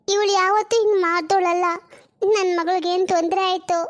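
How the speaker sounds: ordinary talk, nothing marked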